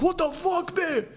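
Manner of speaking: very high, squealing mock panic; hammed-up falsetto alarm; mate caught out. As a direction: panic as your parents walk in. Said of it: "And he's like".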